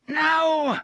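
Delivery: the thug refuses the boss; shouted refusal. Acted. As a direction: bellow it. Gasher refuses.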